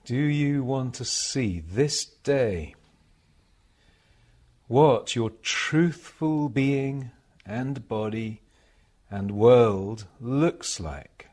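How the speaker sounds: meditation reading. Acted. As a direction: read it as a solemn meditation, pausing between phrases. Reads received passage as a meditation.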